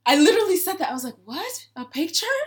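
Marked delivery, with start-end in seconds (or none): 1.2-2.4 high-pitched, incredulous questioning; taken aback. Retold or imitated. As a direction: query it in high-pitched disbelief. Retelling a startled reply to stranger.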